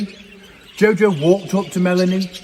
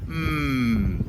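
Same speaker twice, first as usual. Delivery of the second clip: mumbled; 2 semitones lower, 4 dB quieter than usual. A narrator's reading. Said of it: "mumbled the llama".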